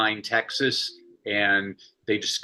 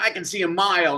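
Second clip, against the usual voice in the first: put-on little girl answering; 7 semitones higher than usual. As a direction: answer like an eager second grader. Child answering teacher.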